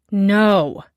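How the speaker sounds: a loud, heavy-stressed "No."; emphatic no. A narrator's reading. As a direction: lean hard on "No.". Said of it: "put a hell a lot of emphasis on the word".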